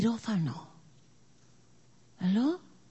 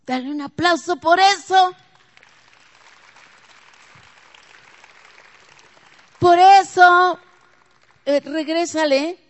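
loud, higher preaching, slowing on each santo; preaching at full force; 8 semitones higher, 13 dB louder than usual. Real, not acted. Preacher rousing the congregation about the holy one, loud and high.

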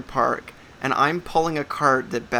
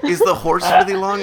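laughing; 6 semitones higher, 9 dB louder than usual. Real, not acted. Laughing at the cart joke.

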